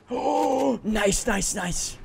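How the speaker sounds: sudden high gasp of delight; excited surprise. Real, not acted. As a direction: jump up thrilled after a gasp. Sudden win in game.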